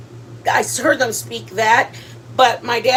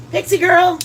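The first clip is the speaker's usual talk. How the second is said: high, louder sing-song call to the dog; pet talk; 7 semitones higher, 4 dB louder than usual. Real, not acted. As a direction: call the puppy by name, higher. Calling the dog.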